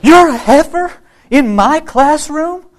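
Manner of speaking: loud, very high, slow disbelief; mock horror. Teacher feigns alarm to crying pupil, horrified outcry. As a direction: screech it as an incredulous, hammed-up question. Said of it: "looked horrified and she went".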